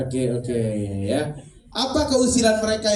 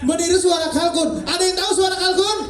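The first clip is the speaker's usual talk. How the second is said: excited; 7 semitones higher than usual. Real, not acted. Host reacts to turkey claim.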